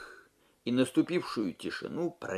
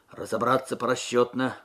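ordinary delivery, nothing marked

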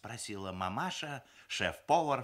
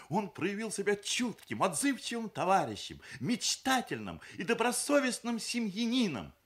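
high, eager official recital; bride's formal testimonial; 13 semitones higher, 4 dB louder than usual. A narrator's reading. Люда vouching for her fiancé before relatives, reciting formally. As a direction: list the virtues like a character reference.

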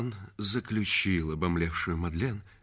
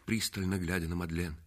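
usual speaking voice

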